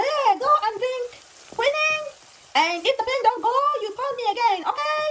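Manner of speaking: put-on high, loud Chinese-accented doctor; high-pitched mock Chinese accent; imitated Chinese accent. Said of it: "making her accent".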